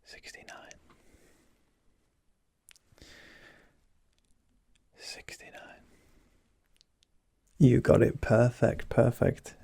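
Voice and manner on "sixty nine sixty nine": faint whisper from further away; barely-there whisper at a distance; distant whisper. Shown as a demonstration. Examiner whispering from further away for a hearing test, faint whisper. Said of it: "I'm gonna whisper in this other one".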